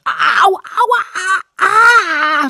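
loud, high cries of pain; acted scream of sudden pain. A narrator's reading. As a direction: yelp and wail in agony. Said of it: "schrie ich und hüpfte verzweifelt".

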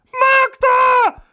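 very high, loud alarmed cry; shouting. A narrator's reading. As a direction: shout her name in alarm. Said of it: "schrien wie aus einem Munde".

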